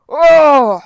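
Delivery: loud, high howl of pain; a giant's sudden shriek of hurt; pained giant yelp. Acted. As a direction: bellow as the javelin hits your eye. Giant poked in the eye.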